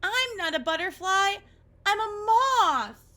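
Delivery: Oscar wailing at his mirror reflection; wailing dismay. Acted. Realising he's a moth.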